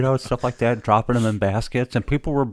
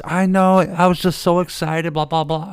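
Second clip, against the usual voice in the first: high, loud, bubbly imitation of the wife; husband mimicking his wife's high, loud excitement; gushing excited wife; 9 semitones higher, 6 dB louder than usual. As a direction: gush with delight, then trail off. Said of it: "And she goes".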